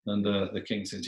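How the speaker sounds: plain reading voice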